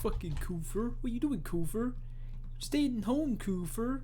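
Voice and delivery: high, mocking jeer. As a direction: sneer it in a squeaky voice. Mocking voice echoing the joke.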